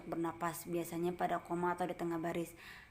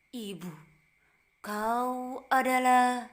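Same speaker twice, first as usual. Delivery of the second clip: "Ibu, kau adalah" with "adalah" pitched high, loud and slow; rising intonation demo; 5 semitones higher, 10 dB louder, much slower than usual. Shown as a demonstration. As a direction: lift "adalah" high and stretch it out. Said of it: "kadang tinggi, kadang rendah".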